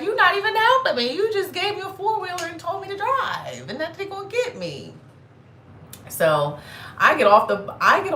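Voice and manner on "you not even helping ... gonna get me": high, loud, exasperated protest; shrill put-upon griping; indignant complaint. Retold or imitated. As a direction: squeal out the outrage at her. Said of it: "I'm just looking at her like".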